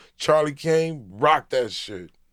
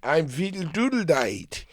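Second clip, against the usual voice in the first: mock Finnish accent, climbing higher on each made-up name; 5 semitones higher than usual. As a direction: rattle off fake Finnish names in a hammed-up Finnish accent.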